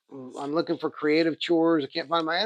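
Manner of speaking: usual speaking voice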